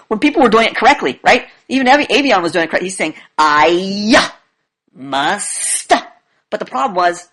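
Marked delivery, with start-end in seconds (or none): 3.4-5.9 stretched words with hard endings; mock drawn-out declaration, word by word; dramatic monotone. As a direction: imitate a student chanting each word slowly. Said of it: "very dramatic monotone".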